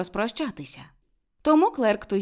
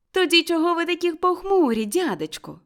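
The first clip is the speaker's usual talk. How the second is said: cheerful; 10 semitones higher, 5 dB louder than usual. A narrator's reading. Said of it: "весело відізвався племінник".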